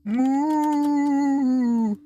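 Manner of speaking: high loud drawn-out moo. Pretend. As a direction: belt a long cow moo. Calling cows with moo.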